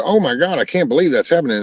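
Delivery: high, fast gasp of disbelief; breathless hammed-up shock; mock astonishment. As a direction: play a gawking viewer, pitch jumping up. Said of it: "they go".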